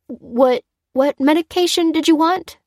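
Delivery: high, hesitant, questioning voice; nervous assistant. A narrator's reading. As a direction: stammer the first word, voice pitched high. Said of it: "said the nervous pharmacy assistant".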